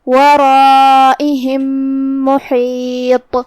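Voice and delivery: ورائہم merged into محیط with nasal meem; idgham of meem. Shown as a demonstration. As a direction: hum one doubled meem. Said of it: "ایک ہی میم پڑھیں گے غنہ".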